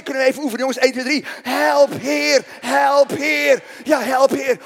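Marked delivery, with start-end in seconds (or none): 1.4-4.6 loud, high drawn-out call; panicked cry for help. Shown as a demonstration. Preacher practising the plea with the crowd, loud cry for help. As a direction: lead the crowd in a desperate plea.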